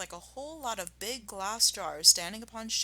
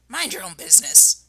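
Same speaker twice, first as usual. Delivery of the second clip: put-on loud shrill drunk rat; shrieking rat; 8 dB louder than usual. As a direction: shriek it back. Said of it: "shrieked Rat".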